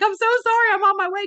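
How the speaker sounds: high, loud, rushed panic; frantic apology. Retold or imitated. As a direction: gush a panicked sorry at the team. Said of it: "I'm like".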